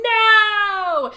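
drawn-out, high, loud mock "no"; hammed-up heroic yell; dramatic cry. Guy throwing himself before the axe, mock heroic cry. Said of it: "throws himself in the way, going".

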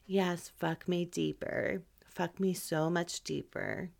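soft, unhurried and mismatched to the words; deliberately underplay it, quiet and leisurely; too-slow pace. Shown as a demonstration. Showing the wrong pace for an intense moment, slow. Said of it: "I'm talking at this pace".

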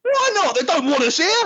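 high, whiny Ben protest; indignant companion. Acted. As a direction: whine it like a sulking companion. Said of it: "I'll be Ben storming off".

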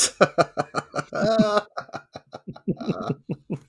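long high laughing fit; helpless laughter. Real, not acted. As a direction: crack up at a rude punchline. Laughing at the punchline.

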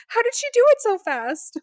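very high, incredulous mock whine; imitating a distressed, disbelieving cry; mock wail. Retold wail of struggling teammate.

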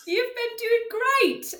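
slow, high, put-on British praise; hammed-up London accent, drawn out; mock British accent. Co-host praises her partner in a mock British accent. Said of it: "practicing my London accent".

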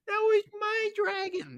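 high, slow mock protest; Baela. Host imitates Baela claiming her dragon, mock outrage.

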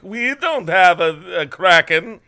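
loud, slow mock Finnish accent; bad Finnish accent, drawn out. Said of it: "That was the worst".

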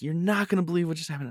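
excited rush. Retold or imitated. Telling friends about the fall.

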